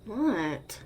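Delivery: loud, annoyed what; irritated what. Acted. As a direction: snap the question at them. Annoyed at the listener staring.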